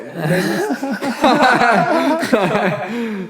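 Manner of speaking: loud high laugh at himself; embarrassed burst of laughter. Real, not acted. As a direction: crack up at your own mistake. Laughs at own slip.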